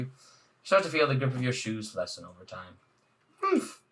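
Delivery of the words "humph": a sharp, high huff of offense; offended huff. Acted. Muffet huffs at the rude human, sharp and high. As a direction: scoff like you've been insulted.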